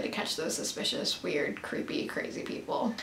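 dropping to a hushed whisper; secretive trailing whisper. Acted. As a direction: whisper the words like they're spooky. Describing suspicious mall people.

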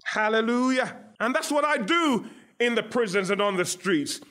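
usual speaking voice

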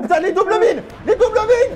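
high excited yell through laughter; excited shout. Real, not acted. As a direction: yell it out amazed. Excited shout at double kill.

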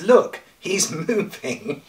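delivers it slowly through a laugh; laughing punchline. Acted. Preacher telling the congregation a joke, laughing. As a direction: let the laugh break into the punchline.

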